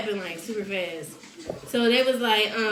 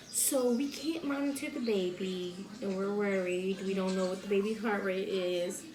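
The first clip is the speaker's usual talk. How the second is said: soft, careful mock staffer voice; gentle concern; 5 semitones lower, 10 dB quieter than usual. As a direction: imitate breaking worrying news quietly. Retold worried staffer breaking news gently.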